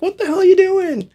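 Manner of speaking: high-pitched mock outrage from a woken household; retold outcry. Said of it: "everyone was up and going like".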